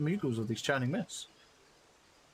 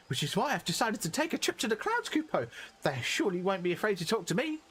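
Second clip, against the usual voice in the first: higher, cheery little moogle; 7 semitones higher than usual. Acted. Streamer voicing the moogle, higher and cheery. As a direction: chirp it like a tiny moogle.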